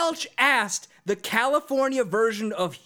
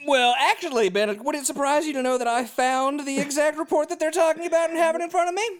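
much higher hammed-up host; cheesy host voice; 8 semitones higher than usual. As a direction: play the smug TV anchor. Mock TV banter, uses names.

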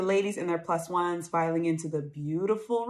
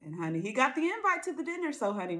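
normal talking voice

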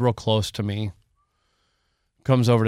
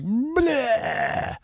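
mocking own whining; 11 semitones higher, slower than usual. [name] mocks his own meeting whining.

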